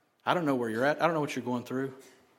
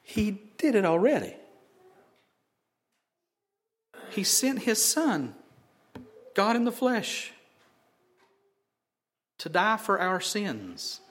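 preaching at full force; 7 semitones higher than usual. Acted. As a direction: preach it out to the whole room. Preacher building to a climax.

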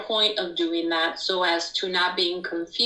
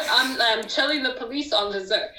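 normal talking voice

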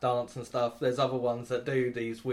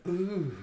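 mock-seductive "ooh", pitched up; seductive moan; 6 semitones higher, 3 dB quieter than usual. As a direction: coo a teasing, hammed-up "ooh". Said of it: "unlocked a new taunt today called Seduce".

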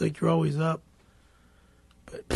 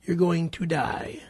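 slow threat; 5 semitones lower, slower than usual. Acted. Vengeful son threatens the mechanic.

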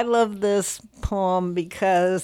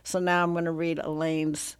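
usual speaking voice

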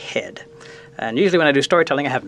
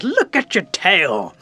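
raised, very drawn-out call; astonished exclamation; 5 semitones higher, much slower than usual. Acted. Animals seeing Raccoon's ringed tail, astonished. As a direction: stretch it out in mock amazement.

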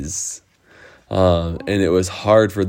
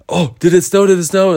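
high, loud, eager questions; excited Coco; 11 semitones higher, 6 dB louder, faster than usual. Acted. Coco waking to wonder about snow, excited high questioning. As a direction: burst out with rising hope. Coco realises it may have snowed.

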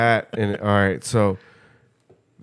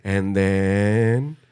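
mock preacher; 2 semitones lower than usual. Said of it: "they were just like, "And then?".

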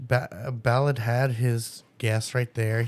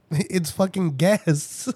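exasperated laugh; 7 semitones higher, much slower than usual. Retold or imitated. Exasperated retold reply, laughing.

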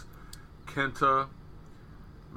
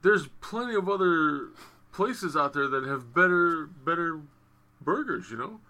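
retold disbelief; 7 semitones higher than usual. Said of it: "Conrad was like".

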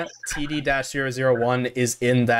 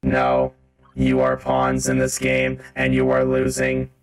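deep, slow gloating villain; robot villain taunt; 11 semitones lower, slower than usual. Acted. The robot repeats his taunt to the player, deep and gloating. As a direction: sneer it down from above.

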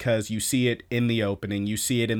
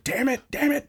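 much higher squeaky catchphrase; sidekick catchphrase; 13 semitones higher than usual. Retold or imitated. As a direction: chirp it like a mascot tic. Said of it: "he accents with".

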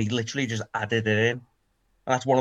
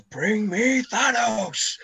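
drawn-out, much higher, slow mock war cry; Thor battle cry; 10 semitones higher, much slower than usual. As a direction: roar it like Thor charging into battle. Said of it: "And he goes".

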